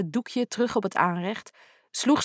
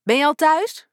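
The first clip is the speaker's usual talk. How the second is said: calling out; 6 semitones higher, 5 dB louder than usual. Acted. Calling through empty house.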